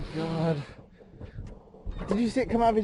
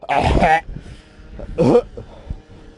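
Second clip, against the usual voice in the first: gagging cough; 2 semitones lower, 4 dB louder than usual. Real, not acted. Gagging at gross sight.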